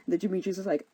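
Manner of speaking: ordinary talk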